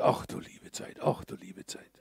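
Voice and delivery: barely breathed, embarrassed whisper; whispered dismay. Retold or imitated. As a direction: mutter it to yourself in shame. Lame man's embarrassed inner thought.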